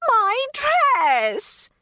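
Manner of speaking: yelling pixie. Acted. Flittle fleeing the rain cloud, high loud dismayed yell. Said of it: "Flittle yelled".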